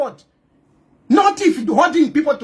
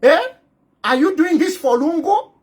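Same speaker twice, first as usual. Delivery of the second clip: ordinary talk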